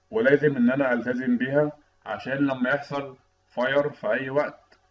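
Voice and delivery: ordinary talk, nothing marked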